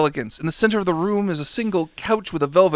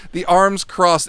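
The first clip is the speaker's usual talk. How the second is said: normal talking voice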